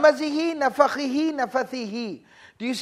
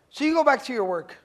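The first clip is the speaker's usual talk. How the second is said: ordinary talk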